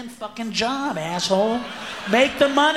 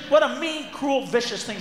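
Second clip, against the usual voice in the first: ordinary delivery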